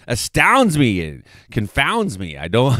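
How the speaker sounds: usual speaking voice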